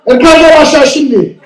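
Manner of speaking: loud high Turkish order; dad shouting in Turkish. Retold or imitated. Dad ordering his son down in Turkish, shouting. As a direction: bark it in Turkish. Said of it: "they shout at you".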